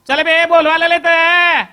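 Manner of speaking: loud, high, slow bellow; hammed-up booming Solapuri shout; ringing shout. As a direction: call out as if across the street. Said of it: "एवढा खणखणीत आवाज".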